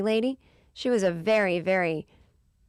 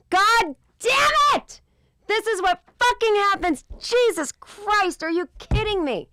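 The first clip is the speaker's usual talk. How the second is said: real yell, much higher and louder, slowed on the curses; furious outburst; 13 semitones higher, 4 dB louder, slower than usual. Real, not acted. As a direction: explode at yourself for missing the joke. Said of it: "mad at myself".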